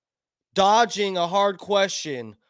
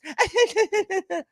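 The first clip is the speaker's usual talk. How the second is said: mock cackle; 12 semitones higher than usual. Mocking Harris's cackle.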